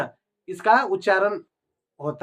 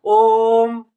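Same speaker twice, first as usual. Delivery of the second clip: ओम said louder, the vowel still held; louder ओम that still lingers; quick but still long om; 7 dB louder than usual. Shown as a demonstration. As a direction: say ओम quickly, yet it stretches. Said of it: "कितना जल्दी से बोलिए तैयो time लगेगा".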